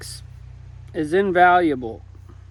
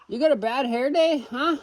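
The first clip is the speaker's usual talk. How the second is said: higher sing-song pet voice; 8 semitones higher than usual. Real, not acted. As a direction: coo at the fluffy hen. Talking to fluffy chickens.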